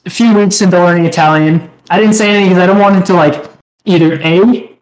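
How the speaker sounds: ordinary delivery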